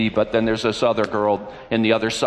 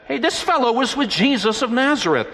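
put-on high-pitched girl calling out an accusation; a girl at the gate calling out about Peter; servant girl voice; 11 semitones higher than usual. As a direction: pitch way up like a young girl pointing him out.

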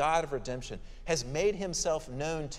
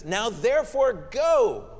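impatient command; 6 semitones higher, 6 dB louder, slower than usual. Acted. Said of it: "God begins to get a little impatient".

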